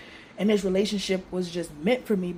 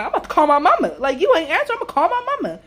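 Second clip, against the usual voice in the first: much higher, louder exasperated outburst; fed up and threatening to call mama; a shrill-free, loud frustrated rant; worked-up yelling; 10 semitones higher, 9 dB louder, much faster than usual. Retold or imitated. Retold frustration, he won't answer.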